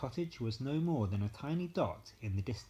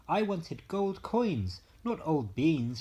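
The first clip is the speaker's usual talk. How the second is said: angry, slow, louder, higher scold; fuming; 6 semitones higher, 5 dB louder than usual. A narrator's reading. Mother scolding Jack over the beans, fuming angrily. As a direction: let her fury rise at the waste. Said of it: "she fumed".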